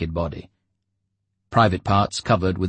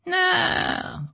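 dealer Oleg. Acted. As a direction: explain it like a shady dealer. Oleg refuses.

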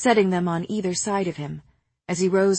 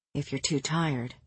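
lower, softer intimate voice; 4 semitones lower, 8 dB quieter than usual. Acted. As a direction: say it low, close and gentle. Rhys whispers to lover.

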